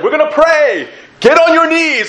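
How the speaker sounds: hammed-up retreat leader shouting; mock leader command. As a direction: imitate the leaders ordering everyone down. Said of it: "and then they're like".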